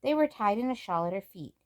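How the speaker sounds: usual speaking voice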